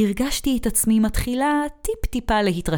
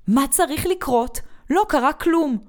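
higher-pitched, defensive lashing out; angry outburst; 7 semitones higher than usual. A narrator's reading. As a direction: burst out at a friend, pitch raised. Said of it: "היא התפרצה עליי מול כולם".

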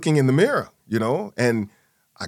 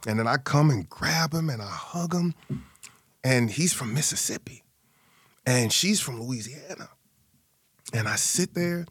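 normal talking voice

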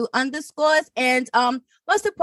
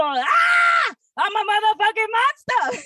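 shouted; 10 semitones higher than usual. Pretend. Verse climax yelled.

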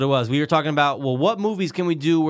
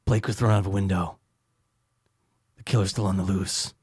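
low, grim noir delivery; Rorschach voice; 7 semitones lower, 5 dB quieter than usual. Pretend. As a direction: growl it like a masked vigilante. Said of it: "Who sounds like that in Watchmen?".

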